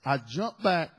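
ordinary talk, nothing marked

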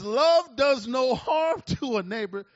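reading the verse while laughing; slow, loud, laughing verse; laughing through it. Real, not acted. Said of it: "Too much laughing, sister".

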